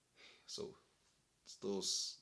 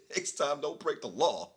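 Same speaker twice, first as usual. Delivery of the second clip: louder, higher smug cop lecture; mock stern cop; 10 semitones higher, 10 dB louder than usual. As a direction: talk down like a petty cop. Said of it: "looked me dead in the eyes and said".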